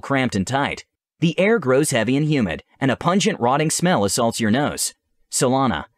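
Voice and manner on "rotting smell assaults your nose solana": normal talking voice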